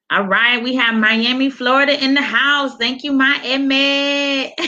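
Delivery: high, happy shout-out with a laugh; excited greeting. Real, not acted. Host welcoming Miami viewers, excited and laughing. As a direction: cheer for your hometown viewers.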